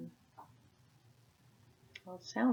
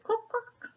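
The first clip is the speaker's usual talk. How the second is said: high clucking cluck, cluck; high hen cluck. Acted. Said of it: "The hen said".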